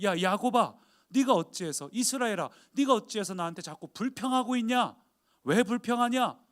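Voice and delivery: put-on a stern father scolding his grumbling child; God scolding. Preacher voicing God's rebuke of Jacob, loud and scolding. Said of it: "하나님께서 그러시는 거예요".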